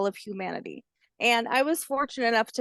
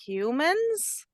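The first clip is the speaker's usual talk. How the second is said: higher, a lightly voiced curious baby alligator; young hatchling voice; 6 semitones higher than usual. Hatchling asks grandmother about humans, lightly childlike. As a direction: ask like a small child wondering.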